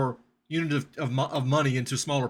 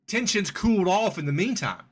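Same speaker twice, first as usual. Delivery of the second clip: ordinary delivery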